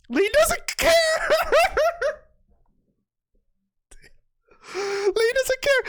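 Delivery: very high squeal dissolving into laughter; giggly high-pitched amazement; laughing disbelief. Real, not acted. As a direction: wheeze it out, overcome with glee. Cracking up at the move.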